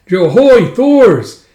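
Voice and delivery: loud, high call across a distance; hearty loud holler; hailing shout. Acted. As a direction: hail him from afar. Hailing Thors from afar.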